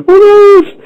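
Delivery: very high, loud squeal of alarm; squeaky panic. Acted. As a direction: squeal it like a panicked cartoon rabbit. Peppy Hare panics.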